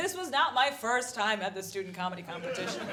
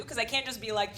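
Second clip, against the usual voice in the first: ordinary delivery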